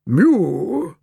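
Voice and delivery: drawn-out mew, pitched high then falling; bored feline meow, sliding downward; bored cat's mew. A narrator's reading. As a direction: mew like a bored old tomcat. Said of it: "said Percival in a bored voice".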